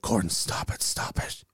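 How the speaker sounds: whispered mock scolding; hushed imitation. Friends telling a drinker to stop, whispered. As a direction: mimic onlookers hissing under their breath. Onlookers hush the embarrassed speaker.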